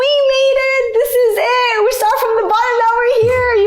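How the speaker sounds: very high, squealed mock celebration; over-the-top thrilled friend voice; excited friends imitation. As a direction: gush it in a sustained high squeal.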